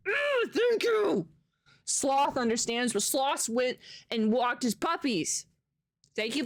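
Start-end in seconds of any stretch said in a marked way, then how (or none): 0.1-1.3 high, loud, drawn-out squeal of thanks; excited squeal. Real, not acted. Streamer squealing thanks to a viewer for a dollar.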